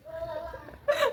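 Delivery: high laugh close to tears; teary laugh. Real, not acted. Laugh after the birth.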